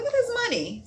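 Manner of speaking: very high-pitched delight; excited squeal. Real, not acted. Tarot reader spotting money cards, squealing with excitement. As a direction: squeal it high at the sight of riches.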